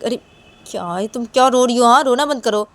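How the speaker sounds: loud, high order to stop; exasperated scolding. Acted. Telling a crying friend to stop, exasperated scolding. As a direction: burst out in acted exasperation, loud.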